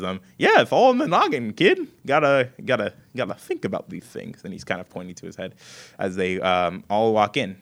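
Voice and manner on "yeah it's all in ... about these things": high, louder cocky voice; Scarecrow. Acted. Scarecrow boasting to the kid, high and loud. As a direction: brag like a know-it-all scarecrow. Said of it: "the Scarecrow goes".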